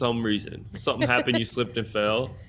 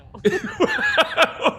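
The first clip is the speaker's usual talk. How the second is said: high burst of laughter; laughing. Real, not acted. Comics laughing over heckler story, high laughter.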